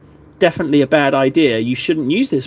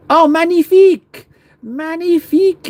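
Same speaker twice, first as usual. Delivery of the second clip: excited mock French; 13 semitones higher, 5 dB louder than usual. Praising his own brushstroke.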